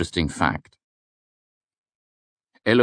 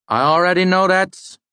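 loud dismissal; 6 dB louder than usual. Acted. Lecturer waving away a student's fact, loudly.

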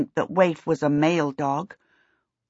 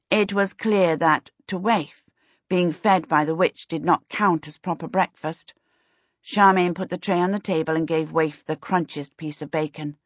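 ordinary delivery, nothing marked

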